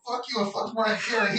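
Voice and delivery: high-pitched reenacted outburst; retold yell. Coworker overhears him snapping at the assistant, retold yell. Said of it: "he heard me yell".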